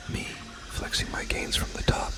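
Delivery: ordinary talk, nothing marked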